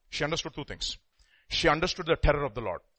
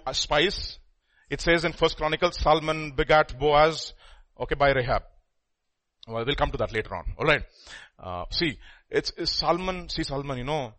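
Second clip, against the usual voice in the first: ordinary delivery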